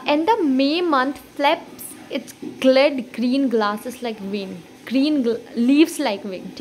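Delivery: drawn out very slowly, sounding every vowel; slow poem reading. Shown as a demonstration. Teacher reading an example line to students, very slow. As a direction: stretch each word so the vowels ring. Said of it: "For example".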